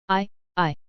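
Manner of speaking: halting, quiet stammer; stuttering. Acted. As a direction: stumble over the words. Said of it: "Aemon stuttered".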